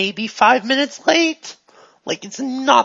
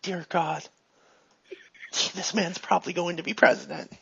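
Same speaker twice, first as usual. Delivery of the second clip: hushed, much softer aside; very quiet; 5 semitones lower, 10 dB quieter than usual. Acted. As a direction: say it under your breath. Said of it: "she very quietly goes".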